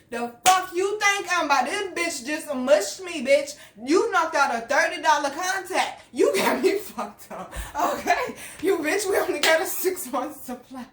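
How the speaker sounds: high, loud, indignant rant; worked-up retelling of a furious outburst; angry outburst. Retold or imitated. Acting out her outburst after being mushed.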